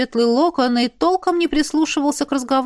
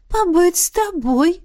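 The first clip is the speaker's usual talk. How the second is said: put-on high, slow, coy girl's voice; shy confession; 7 semitones higher, slower than usual. A narrator's reading. Izzy tells Ash she wants to be near him, high and coy. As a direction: admit it sweetly, lashes fluttering. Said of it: "призналась она".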